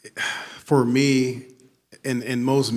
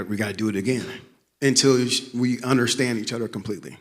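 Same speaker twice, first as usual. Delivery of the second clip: ordinary talk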